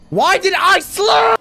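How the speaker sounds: slow, drawn-out high scream; anguished scream. Real, not acted. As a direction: wail it at full volume in frustration. Outburst at own mistake.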